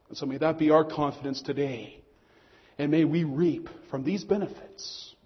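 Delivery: normal talking voice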